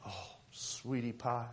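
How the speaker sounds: slow, soft, sugary "sweetie pie"; syrupy Southern. Retold or imitated. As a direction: drip honey in a Southern drawl. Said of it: "In church".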